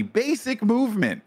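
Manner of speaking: high, emphatic restatement; real exasperation. Real, not acted. Repeats point to friend, exasperated.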